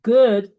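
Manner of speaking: "Good" said alone, loud and clear as an English model word; teacher modelling the English word "Good"; model pronunciation. Shown as a demonstration. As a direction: announce the English word "Good" loudly and cleanly. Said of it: "tenemos good".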